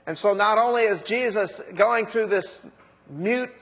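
ordinary delivery

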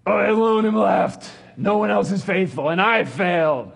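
high, loud self-pitying whine; whiny Elijah. Acted. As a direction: wail it like a sulking prophet. Elijah complains in the desert.

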